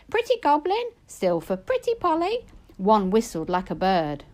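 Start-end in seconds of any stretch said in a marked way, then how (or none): 0.1-2.4 very high, squawking parrot; jolly goblin squawking like a parrot. A narrator's reading. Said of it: "One parrot-voiced and jolly cried".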